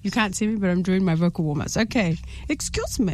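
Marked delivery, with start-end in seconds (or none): 2.5-3.1 higher, softer attempt at an Indian accent; Indian-accented demo take, high and soft. Shown as a demonstration. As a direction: try a careful Indian accent, pitched up and quiet. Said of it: "In an Indian accent".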